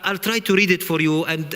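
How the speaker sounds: ordinary talk, nothing marked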